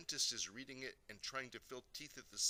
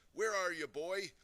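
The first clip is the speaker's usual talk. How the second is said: high, loud searching shout; 7 semitones higher, 9 dB louder than usual. A narrator's reading. Daddy looking for his invisible son, shouting. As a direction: shout for a missing boy. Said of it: "and he shouts".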